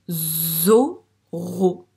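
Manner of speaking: "Zoro" loud, with a long buzzed Z; Z appuyé. Shown as a demonstration. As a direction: split "Zoro" in two, stretching the Z and lifting the first syllable. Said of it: "il fait bien le son Z". (« Zoro » découpé, avec un Z très allongé)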